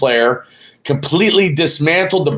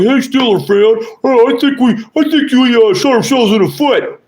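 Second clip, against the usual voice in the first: whiny mock Ravens fan, much higher and louder; 10 semitones higher, 4 dB louder than usual. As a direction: mimic a sulking rival fan making excuses. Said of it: "some fucking guy just pops on and's like".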